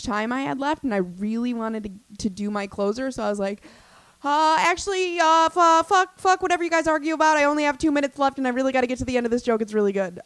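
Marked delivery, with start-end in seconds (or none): 4.2-10.2 loud, fast brushing-off; mock rude dismissal; rude crowd-work retelling. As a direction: blow off the couple to get to your closer. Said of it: "so rude".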